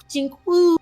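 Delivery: high sound effect and whoop; playful whoop. Acted. Player acts out Mira's thorn swing with a whoop. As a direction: mimic a web shot then cheer. Mira swinging like Spider-Man.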